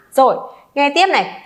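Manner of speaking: usual speaking voice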